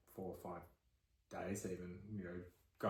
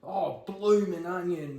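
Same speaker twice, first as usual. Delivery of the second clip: high, loud, drawn-out hammed-up Aussie; thick Australian accent; 8 semitones higher, 5 dB louder, slower than usual. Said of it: "That's a thick accent".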